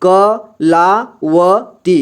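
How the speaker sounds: Kalavati drawn out very slowly, syllable by syllable; slow sounding out. Shown as a demonstration. Teacher slowly sounding out a name for learners. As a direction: stretch each syllable of Kalavati for beginners. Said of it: "यहाँ पे लिखा हुआ है कलावती".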